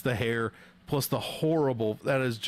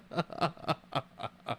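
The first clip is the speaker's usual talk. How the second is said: real laugh; 3 semitones higher, 5 dB quieter than usual. Real, not acted. Streamer mocking a fighter's haircut, laughing. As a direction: run of soft, staccato laughter.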